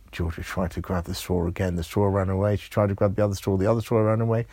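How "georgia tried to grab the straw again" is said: ordinary delivery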